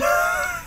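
very high squeal of laughter; shrieking laugh. Real, not acted. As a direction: burst out squealing. Laughing at the complaint note.

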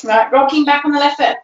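quick, rushed count-in; fast. Shown as a demonstration. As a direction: rattle it off at speed. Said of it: "Should we try that ten times faster?".

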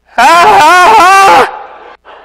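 high shouted laugh through tears; crying laugh. Retold or imitated. Brother laughing while crying, high shouted laugh. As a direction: bellow a defiant ha ha ha. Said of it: "my brother does this".

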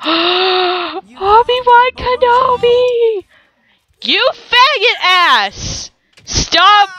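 high, slow, drawn-out anguished cry; outraged cry. Real, not acted. Gamer watching her favourite sim get booed, outraged high cry. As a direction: wail it like your hero is wronged.

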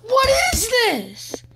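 high, drawn-out exasperation; exasperated outcry. Real, not acted. Gamer at his hopeless linemen, exasperated high wail. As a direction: wail it slowly in disbelief.

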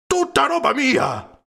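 horrified at his ruined library; horrified cry. A narrator's reading. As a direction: cry out aghast. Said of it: "gridò inorridito".

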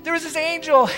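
women rushing to tell the disciples; breathless excitement. Acted. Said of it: "they're going".

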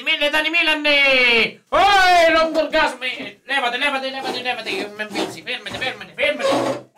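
shouting out a donation, excited; loud hype shout. Real, not acted. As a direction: belt it out like a streamer hyping. Reading donation out loud, hyped.

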